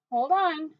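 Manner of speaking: calling out. Real, not acted. Telling family off camera to wait, called out. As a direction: call out to the next room.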